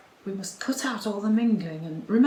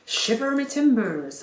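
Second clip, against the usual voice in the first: mock pirate accent, a bit louder; hammed-up pirate accent for a stock phrase; 4 semitones higher, 6 dB louder than usual. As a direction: toss off a stock pirate line. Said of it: "a few choice phrases like".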